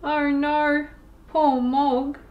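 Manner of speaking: plain reading voice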